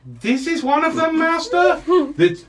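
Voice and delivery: gnome voice. Acted. Gnome and wizard bickering.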